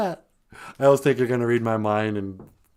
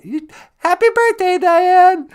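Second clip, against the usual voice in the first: high, loud birthday shout; excited birthday cheer; 9 dB louder than usual. Real, not acted. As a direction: call it out with delight. Birthday greeting called out.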